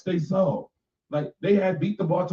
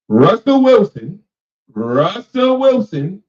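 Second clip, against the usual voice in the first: louder, slow call of the name; emphatic name call; 5 semitones higher, 9 dB louder, slower than usual. Real, not acted.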